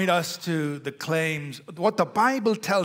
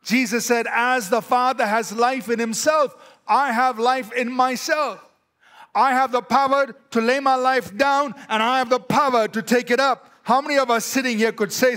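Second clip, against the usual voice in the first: loud, raised proclamation of Jesus' words; forceful preaching; 7 semitones higher, 6 dB louder than usual. Acted. Preacher booming Jesus' claim to the congregation, forceful. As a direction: proclaim it to the whole room.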